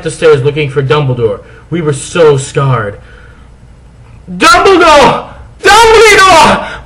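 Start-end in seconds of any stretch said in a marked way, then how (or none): 4.3-6.8 high, slow loud calls; calling out. Acted. Two goths yelling for Dumbledore, desperate. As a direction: call his name up the stairs. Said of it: "we both yelled".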